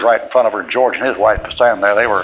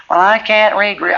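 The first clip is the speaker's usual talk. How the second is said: flustered woman; 9 semitones higher than usual. Retold or imitated. Flustered woman caught out, quoted.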